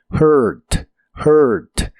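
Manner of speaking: hurt with the tongue pulled far back, a growly monster R. Shown as a demonstration. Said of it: "con tu lengua lo más atrás que puedas y debe sonar como monstruo".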